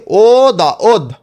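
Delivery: very slow, ও stretched before দা ওধ; piece by piece. Shown as a demonstration. Teacher builds the first syllable for students, ও দা ওধ slowly pieced together. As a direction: spell out "ও দা ওধ" slowly, sound by sound. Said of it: "টুকরো টুকরো করে উচ্চারণ".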